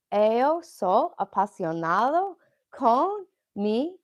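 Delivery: very slow, careful learner phrasing; halting beginner Portuguese; slow Portuguese attempt. Shown as a demonstration. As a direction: sound out the phrase word by word. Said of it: "I'm learning Portuguese".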